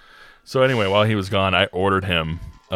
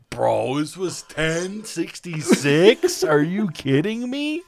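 high, slow, disbelieving whine; mock-indignant complaint about the bill; outraged friend; 10 semitones higher, much slower than usual. Friend griping to the narrator about the bill, high slow whine.

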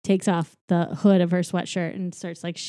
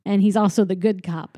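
ordinary talk, nothing marked

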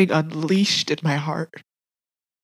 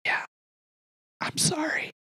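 very soft, high, near-whispered; hushed, retold compassion; God's tender apology; 9 semitones higher, 12 dB quieter than usual. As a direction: breathe it out barely audibly. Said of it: "And God's going".

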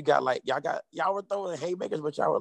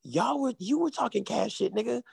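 heated retort; 9 semitones higher than usual. Retold or imitated. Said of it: "I said".

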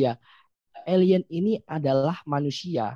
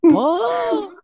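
surprised squeal; 7 dB louder than usual. Pretend. Exaggerated alien reaction.

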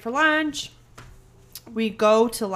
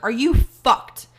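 retold outrage; 2 semitones higher, 8 dB louder, slower than usual. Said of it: "I'm like".

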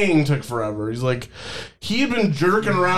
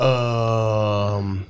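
hesitant guy; 8 semitones lower than usual. Retold or imitated.

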